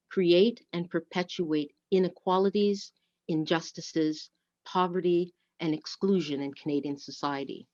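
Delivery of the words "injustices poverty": usual speaking voice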